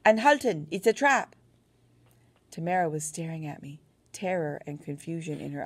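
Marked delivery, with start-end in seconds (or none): 0.0-1.3 high, loud warning shout; yelling. A narrator's reading. Narrator warning the Germans of a trap, loud shout. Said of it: "I yelled to them in German".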